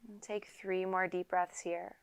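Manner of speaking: unhurried yoga teacher leading a quiet meditation; guided meditation. Acted. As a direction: calmly guide them into stillness, leaving space to breathe. Leads a seated guided meditation.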